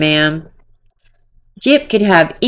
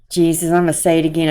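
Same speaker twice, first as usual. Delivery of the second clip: ordinary talk, nothing marked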